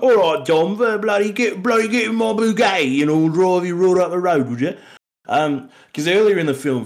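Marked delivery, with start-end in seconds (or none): 0.0-4.8 high, loud London accent, roughly put on; doing a rowdy British geezer accent for Dom; British accent impression. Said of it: "the chick who's like".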